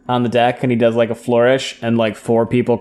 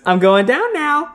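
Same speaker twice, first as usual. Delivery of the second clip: high sing-out line, held up in pitch; high-pitched quote; 13 semitones higher than usual. Acted. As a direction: call it out high and airy, acting the character. Retold gleeful announcement from story.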